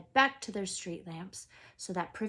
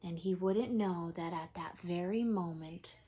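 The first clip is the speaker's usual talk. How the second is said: plain reading voice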